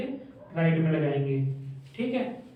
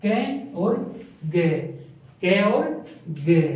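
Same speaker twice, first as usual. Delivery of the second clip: split into syllables; much slower than usual. Shown as a demonstration. Teacher to class, sounding out कैग syllable by syllable. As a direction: say कै and गे as separate, spaced-out syllables. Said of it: "कै के बाद ए की sound आ रही है".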